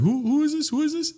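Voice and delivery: high, soft puzzled inner voice; standoffish wondering. Retold or imitated. Recalling his thoughts when fans approach, standoffish wondering. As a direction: wonder warily in a small, high voice. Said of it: "I get a little standoffish".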